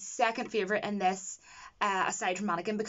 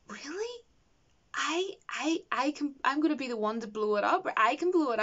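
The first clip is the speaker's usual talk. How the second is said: emotional, choked up; 4 semitones higher than usual. Retold or imitated. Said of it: "just all emotional and everything".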